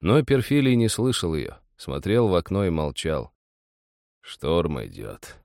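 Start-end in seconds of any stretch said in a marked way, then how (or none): 4.2-5.4 low, slow, hushed; muffled. A narrator's reading. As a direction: mutter it slowly and low. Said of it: "сказал он глухо".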